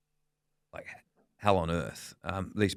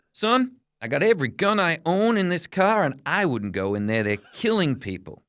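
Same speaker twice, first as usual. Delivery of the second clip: raised, emphatic retold warning; imitated cop's warning; 8 semitones higher, 5 dB louder than usual. Copper warning the reporter off going in, acted emphatically. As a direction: start high and loud, like a cop warning someone off.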